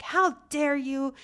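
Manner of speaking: high, slow, indignant "how dare you"; imitating upset grandma. Grandma scolding granddaughter over the apology, mock outrage. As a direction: mimic a scandalized grandma, drawn out. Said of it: "She was really upset".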